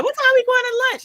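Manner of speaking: nagging coworker hungry for lunch; eager coworker. Retold or imitated. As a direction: ask about lunch like you can't wait. Coworker quoted asking about lunch.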